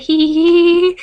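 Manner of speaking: loud high mock giggle. [name] giggling at the scare, loud mock giggle. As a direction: hee hee it out loud.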